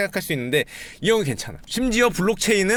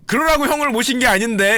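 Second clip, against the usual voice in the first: flustered host; 6 semitones higher, 4 dB louder than usual. Retold or imitated. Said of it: "사회자가 잠깐 당황한 표정을 지으시면서".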